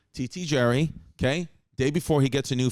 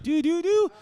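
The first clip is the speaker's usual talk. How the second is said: phone tone imitation; 3 dB louder than usual.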